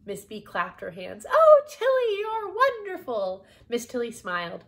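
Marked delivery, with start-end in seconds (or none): 1.3-3.5 very high, loud gush of admiration; delighted exclamation. Acted. Miss B clapping for Tilly's plan, delighted. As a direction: cry it out high and thrilled.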